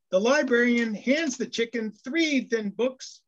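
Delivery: ordinary delivery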